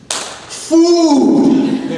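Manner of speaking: high, drawn-out cry; exclaimed answer. Real, not acted. Preacher answering his own question to the room, exclaiming. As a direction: call out the answer with gusto.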